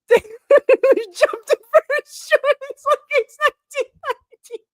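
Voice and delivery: high squeal breaking into laughter; laughing to tears. Real, not acted. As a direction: barely get the words out for laughing. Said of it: "I have legitimate tears in my eyes".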